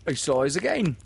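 high, loud startled pirate. Acted. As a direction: sailors thrown off guard. Starkey remarks.

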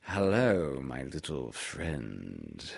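put-on an old owl being gentle; kindly. A narrator's reading. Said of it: "in a kindly way".

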